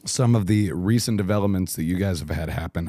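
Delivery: ordinary talk, nothing marked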